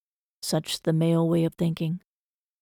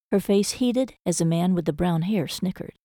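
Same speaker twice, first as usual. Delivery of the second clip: ordinary delivery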